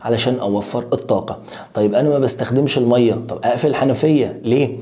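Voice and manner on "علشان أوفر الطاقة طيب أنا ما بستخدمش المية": ordinary talk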